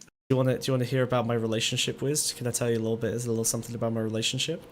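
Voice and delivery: low, hushed, close-up murmur; real near-whisper, intimate and teasing; whispering in someone's ear. Real, not acted. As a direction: softly coax the listener, pitched low. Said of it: "you don't have to whisper in my ear".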